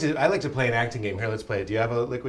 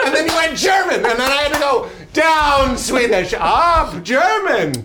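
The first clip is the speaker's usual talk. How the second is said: mock shout; 13 semitones higher, 6 dB louder, slower than usual. Real, not acted. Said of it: "mock accent complaint".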